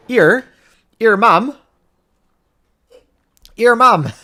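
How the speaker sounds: high, loud Bristol "Ear mum"; mock West Country greeting; Bristol accent. Playing his wife's Bristol folk with a Bristol accent. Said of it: "That's my Bristol accent".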